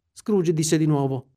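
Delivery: usual speaking voice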